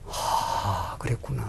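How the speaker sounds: soft unvoiced sigh of understanding; preacher's own realization, whispered. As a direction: breathe it out as it finally dawns. Voices his own realization.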